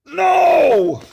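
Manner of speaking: high, loud despairing "No!"; anguished cry. Pretend. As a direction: wail it as if the answer hurts. Reader cries out at the answer.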